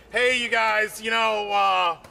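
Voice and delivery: usual speaking voice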